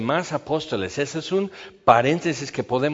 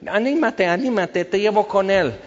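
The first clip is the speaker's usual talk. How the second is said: higher, loud cheering with a laugh; a mock-cheery crowd urging the blind man on; eager encouragement; 7 semitones higher, 3 dB louder than usual. Crowd now encourages Bartimaeus.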